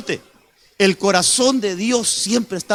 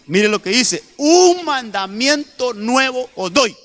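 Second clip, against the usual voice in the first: raised-pitch declamation, scripture lines slowed and stretched; emphatic preaching; 5 semitones higher, 4 dB louder than usual. Acted. Preacher reads Jesus's new commandment to the congregation, emphatic preaching. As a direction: proclaim the verses, drawing out the key words.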